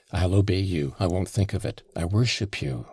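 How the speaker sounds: usual speaking voice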